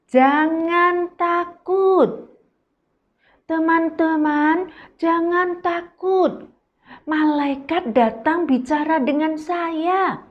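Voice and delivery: calm the frightened crew; reassuring Paul. Acted. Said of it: "Pak Paulus berdiri bilang". (Paulus menenangkan, pelan dan tinggi)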